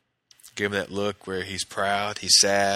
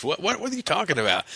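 high-pitched mock bewilderment; startled, stammered question; imitated startled disbelief; 9 semitones higher, faster than usual. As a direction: act out a baffled reply, voice jumping high. Startled reply to pregnancy announcement.

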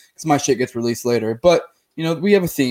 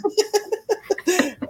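high-pitched burst of laughter. Real, not acted. Laughs at own dark joke.

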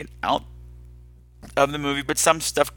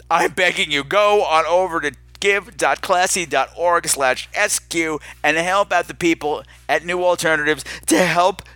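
normal talking voice

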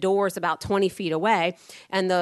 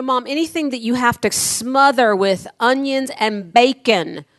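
higher and louder, building protest; rising exasperation over the liver; exasperated complaint; 5 semitones higher, 8 dB louder, slower than usual. Retold or imitated. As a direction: plead with mom about the liver. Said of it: "I said".

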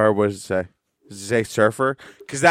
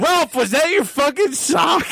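shouting; 13 semitones higher than usual. Real, not acted. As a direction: yell it out in shock. Yells at Ralph.

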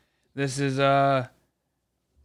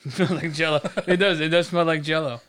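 laughing; 3 semitones higher, 4 dB louder than usual. Real, not acted. Amused agreement about the smell.

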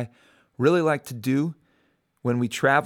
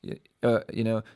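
ordinary talk

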